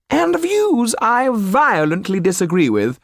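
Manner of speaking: haughty Parisian aristocrat interrupting; Madame Clermont. Acted. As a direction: cut in with outrage. Narrator voices Madame Clermont.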